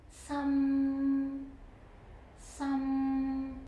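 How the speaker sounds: some held flat in the middle; mid level tone. Shown as a demonstration. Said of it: "The third tone is some".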